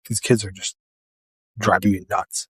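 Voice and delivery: usual speaking voice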